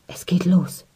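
faint voiceless whisper. A narrator's reading. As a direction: whisper it in dread. Frightened whispers before the attack.

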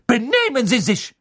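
very high, loud indignant shriek; shrieking nurse. A narrator's reading. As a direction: shriek his name to stop him. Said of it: "kreischte Madame Pomfrey".

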